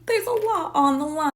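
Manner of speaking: "a lot on the line" said high and dramatic. Shown as a demonstration. Vlogger hyping the stakes of the bet, high. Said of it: "So there's a lot on the line".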